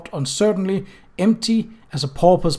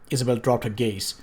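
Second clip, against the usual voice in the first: ordinary delivery, nothing marked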